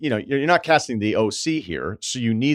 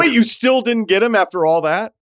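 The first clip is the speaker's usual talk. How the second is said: surprised disbelief; 6 dB louder than usual. Real, not acted. Host reacts to rejection news.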